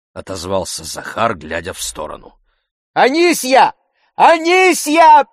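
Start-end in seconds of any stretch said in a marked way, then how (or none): 2.9-3.7 loud, very high call; shouting for servant. A narrator's reading. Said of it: "закричал Обломов". 4.2-5.3 long, piercingly high yell; shouting for servant. A narrator's reading. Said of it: "закричал Обломов".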